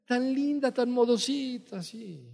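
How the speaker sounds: high, gushing praise, slowing down with a laugh; gushing church ladies. Retold or imitated. Church sisters praising the pastor's daughter, high and gushing. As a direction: coo over her like admiring church sisters. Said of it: "Y luego decían".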